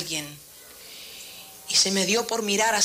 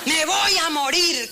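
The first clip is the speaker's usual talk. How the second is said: slow, higher mock wail; queja dramática imitada; 8 semitones higher, 3 dB louder, slower than usual. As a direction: whine it like a hammed-up Elijah. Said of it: "Elías también le dijo un día". (lamento exagerado y burlón)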